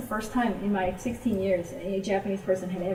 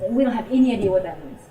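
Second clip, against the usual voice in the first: retold forceful objection; 7 dB louder, faster than usual. Friend objecting to missionary, retold.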